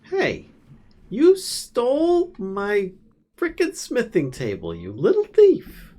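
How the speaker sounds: high, loud playful accusation; mock outrage. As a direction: accuse them in hammed-up indignation, drawing words out. Mock quarrel over stolen smithing table.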